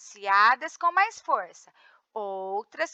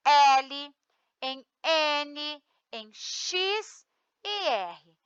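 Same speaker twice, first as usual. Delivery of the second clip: slow careful listing; 2 semitones higher, much slower than usual. Shown as a demonstration. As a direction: spell out the letters slowly, one by one. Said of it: "terminadas em". ("em X e R" bem devagar e suave)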